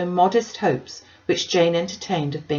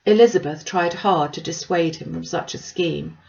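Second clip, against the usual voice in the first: ordinary delivery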